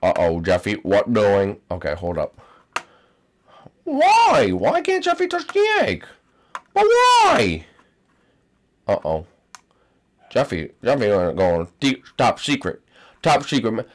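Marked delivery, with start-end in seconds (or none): 3.8-7.6 high whiny why. Acted. Character protests.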